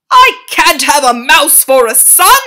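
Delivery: very loud, high shriek; appalled father. A narrator's reading. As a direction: shriek in horror. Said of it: "shrieked Mister Jenkins".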